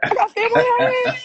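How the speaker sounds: high, fast mock squeal; giddy high-pitched acted reply; excited exclamation. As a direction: squeal it back, very high and quick.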